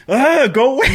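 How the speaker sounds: high, laughing whine at an intruder; hammed-up interrupted artist; flustered Michael Myers. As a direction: shoo them off in mock distress. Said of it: "he's like".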